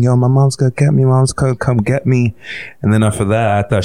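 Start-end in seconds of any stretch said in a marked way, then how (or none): 0.0-2.3 put-on excited kid boasting. Little boy telling the village his mom is coming, excited and raised. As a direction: crow it like a thrilled little boy.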